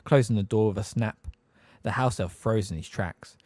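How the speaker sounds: ordinary delivery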